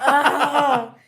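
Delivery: high laughing squeal; delighted squeal. Real, not acted.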